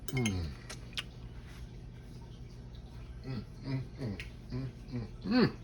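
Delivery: quiet contented mm-mm while chewing; delighted tasting hums; soft savoring hums. Real, not acted. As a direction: hum softly over each bite. Eating, savoring.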